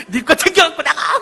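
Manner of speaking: high, loud screech of an acted angry wife; shrieked order to get out; furious yell. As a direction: scream it at your husband in mock fury. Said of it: "소리를 빽 질렀더니".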